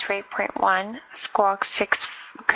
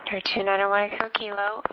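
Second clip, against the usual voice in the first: usual speaking voice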